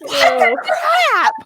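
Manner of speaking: high, drawn-out mock outrage; acted-out incredulous protest; shocked disbelief. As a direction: stretch it out, appalled. Retells shocked reaction to brother.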